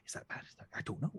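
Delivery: worried whisper; a hushed, uncertain whisper. Acted. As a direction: whisper the doubt to yourself. Goose king unsure whispering.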